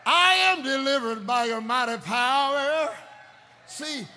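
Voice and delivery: loud, slow, ringing sermon voice; fiery preacher calling out; full-force preaching. Acted. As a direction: preach it out to the whole church.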